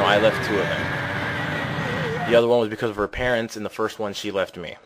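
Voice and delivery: ordinary talk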